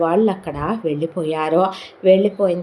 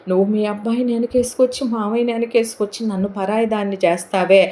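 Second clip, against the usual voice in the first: mock complaint; 4 semitones higher, faster than usual. Daughter-in-law complains to mother-in-law.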